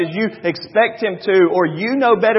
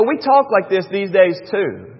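usual speaking voice

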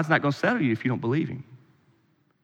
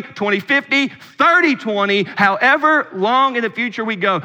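raised voice building through the list; emphatic preaching; 6 semitones higher, 4 dB louder than usual. Real, not acted. Preacher telling congregation God is there in both directions, emphatic preaching. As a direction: drive each phrase home from the pulpit.

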